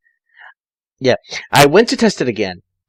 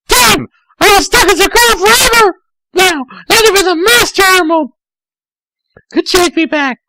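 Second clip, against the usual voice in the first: put-on much higher, louder girlish wail; despairing girl voice; 5 dB louder than usual. Tails stuck as a girl forever, high wailing voice.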